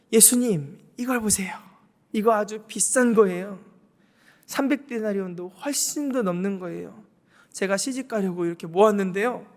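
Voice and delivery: hammed-up boastful giver begging Jesus; showy woman's plea. Preacher voicing a boasting woman asking Jesus to take care of her.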